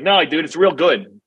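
lightly put-on slow Jersey accent; a hint of a Jersey guy, drawn out. Mocking a DJ, saying it in a lightly slow Jersey accent. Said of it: "like, a Jersey accent".

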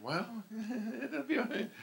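laughing through a high squeak. Retold or imitated. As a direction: giggle it out high. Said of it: "I'm like".

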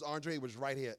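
low, soft matter-of-fact wife; calm wife. Retold or imitated. Wife calmly showing husband the lost thing, low and soft. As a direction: answer like it's obvious. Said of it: "She comes down and says".

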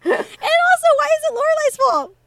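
high, loud and indignant; indignant outburst. Real, not acted. Defending Lorelai against the rude kids, indignant and loud. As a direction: protest it like it's so unfair. Outraged on the character's behalf.

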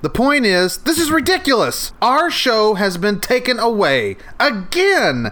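high whiny blame; upset. Acted. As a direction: complain at the top of your voice. Blaming Ollie, upset.

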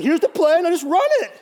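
loud, high, hollered coach impression. Coach gives a rookie the play, loud high hollering. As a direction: yell instructions like a caricatured coach. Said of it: "that's how he talked".

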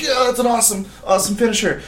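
mock hype. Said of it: "and it's like".

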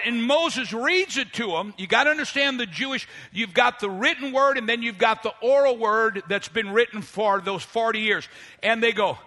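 ordinary talk, nothing marked

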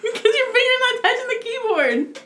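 high, squealing laughing accusation; squeaky, giggly protest. Real, not acted. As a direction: argue back through laughter.

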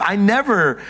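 ordinary delivery

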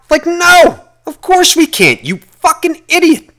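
high, exasperated put-down; high mocking no, you idiot; scornful retort. Real, not acted. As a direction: scoff it down at them.

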